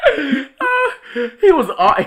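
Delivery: crying with laughter; laughing hard. Real, not acted. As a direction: laugh till your voice breaks. Laugh-crying while retelling.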